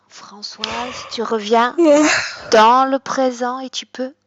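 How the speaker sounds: firm, loud call to wake up. Acted. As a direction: raise the voice to end the trance. Calling client out of trance.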